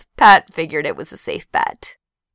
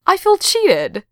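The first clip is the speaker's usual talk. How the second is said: high-pitched complaint; put-out, high whine of grievance; mock-aggrieved; 13 semitones higher, slower than usual. A narrator's reading. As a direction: protest like you were cheated. Duncan complains about missing out.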